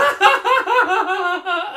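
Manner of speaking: high, loud burst of laughter; real helpless laughter. Real, not acted. Cracks up at the word dame.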